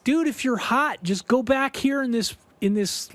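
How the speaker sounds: high, easygoing dude voice; brushing off the worry; lightly voiced laid-back Andy; carefree bandmate. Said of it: "And Andy goes".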